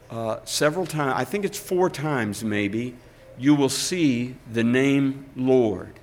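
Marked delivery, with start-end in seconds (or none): none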